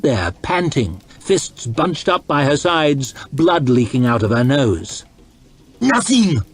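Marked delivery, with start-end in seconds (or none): 5.8-6.4 high, snarling retort; defiant high snarl. A narrator's reading. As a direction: snap the word back at him. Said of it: "she snarled".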